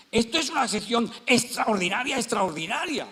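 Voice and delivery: acted astonishment, hitting each word hard; loud amazement. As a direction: proclaim it loud and high. Retold amazed exclamation in noisy bar.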